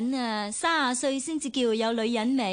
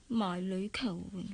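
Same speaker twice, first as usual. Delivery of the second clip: much softer, lower bitter aside; under her breath; 5 semitones lower, 8 dB quieter than usual. Real, not acted. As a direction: mutter it so father barely catches it. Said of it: "你講咩話？".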